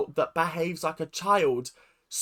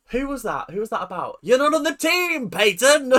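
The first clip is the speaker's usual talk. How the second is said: mock coach dismissal; 5 semitones higher, 5 dB louder than usual. Mimicking the coach cutting dancers from the team, mock announcement. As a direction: call it out like a mocking coach.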